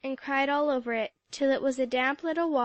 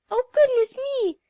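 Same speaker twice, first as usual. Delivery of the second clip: much higher, louder startled cry; little scream; 12 semitones higher, 10 dB louder than usual. A narrator's reading. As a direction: shriek in surprise at the hat. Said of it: "gave a little scream".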